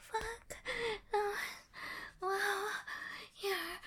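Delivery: breathy, rising moans of pleasure; loud pleasured moans; loud moaning. Acted. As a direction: let the moans build and peak. Climax in roleplay.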